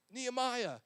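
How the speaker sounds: loud taunting call up to the wall. Acted. Enemies on the ground call up to Nehemiah, loud and taunting. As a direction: enemies shouting up at the builder.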